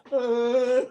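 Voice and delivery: high put-on groaning oh; mock groan. Mimicking Scouse outside work, high mock groan. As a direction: moan it like a sulking mate. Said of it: "Scouse was like".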